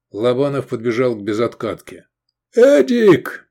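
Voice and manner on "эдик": much higher, loud yell. A narrator's reading. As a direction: yell his name across the street. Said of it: "проорал он".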